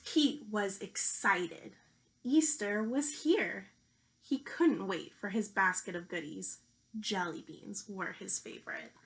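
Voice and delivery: lively picture-book storytelling voice; reading to children. Acted. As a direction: read it out like a teacher at story time.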